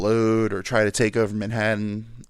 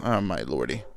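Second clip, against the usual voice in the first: ordinary delivery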